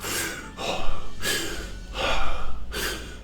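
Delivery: slow, deep breath out; long exhale. Shown as a demonstration. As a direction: let out one long breath. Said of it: "I can just go".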